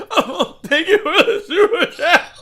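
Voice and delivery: high, slow, squealing through laughter; hammed-up laughing gratitude; mock laughing thanks. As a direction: cackle out a sarcastic thank-you. Mocking the streamer's thanks, laughing.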